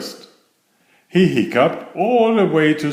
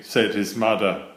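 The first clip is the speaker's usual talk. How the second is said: ordinary delivery, nothing marked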